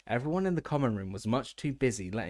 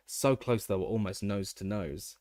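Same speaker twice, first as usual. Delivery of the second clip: plain reading voice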